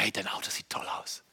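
schnell und fast tonlos geflüstert; geflüstertes Lob. Retold or imitated. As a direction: leise hingeworfenes Kompliment. Leises Lob an den Fahrer. (flüsternd gelobt)

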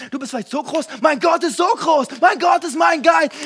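preacher leading the crowd in a sung declaration; preaching at full force. Acted. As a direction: ring it out like a chant.

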